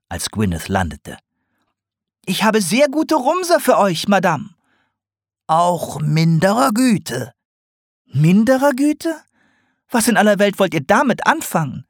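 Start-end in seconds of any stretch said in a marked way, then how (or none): 2.3-4.5 much higher, bright owl voice; eager owl trader. A narrator's reading. Owl Gwyneth offers goods. 5.5-7.3 higher, slow, falling on Güte; dry potter's remark. A narrator's reading. Sarg's reply, echoed. 8.1-11.9 very high, rising on "Güte"; owl's astonished echo. A narrator's reading. Owl echoes in disbelief.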